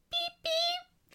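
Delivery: high squeaky beep beep; beeping horn noise. Pretend. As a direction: honk like a toy car. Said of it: "That's not a train sound".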